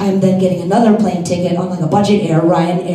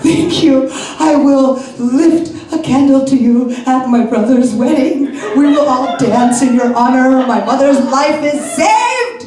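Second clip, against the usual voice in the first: grateful gushing; 5 semitones higher, 3 dB louder than usual. Retold or imitated.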